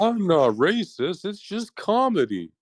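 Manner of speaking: mock comedian. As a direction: sneer it as a self-excusing comic.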